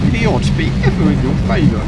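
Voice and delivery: loud, high theatrical proclamation; Hunger Games announcer. Acted. Gamer quoting Hunger Games, in a grand loud proclamation. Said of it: "sounds a bit like, uh, Hunger Games".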